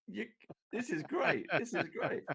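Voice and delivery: high, laughing delight; game master thrilled the players walled themselves in; gleeful GM. Real, not acted. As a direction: cackle with evil glee at the trap.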